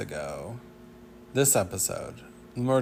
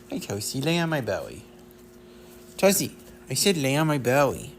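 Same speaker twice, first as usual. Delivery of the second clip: a bit louder, coaxing dog command; telling the dog; 4 semitones higher, 6 dB louder than usual. Pretend. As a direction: coax the dog to lie down.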